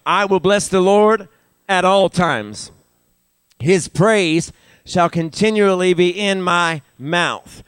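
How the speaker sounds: measured, ringing pulpit reading; scripture reading. Real, not acted. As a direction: proclaim each phrase of the psalm slowly. Reads the psalm aloud.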